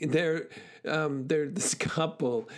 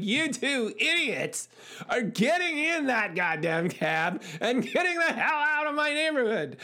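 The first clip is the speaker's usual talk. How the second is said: fed-up neighbor yelling; 8 semitones higher than usual. Retold or imitated. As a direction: tell them off from the window. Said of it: "And I was like".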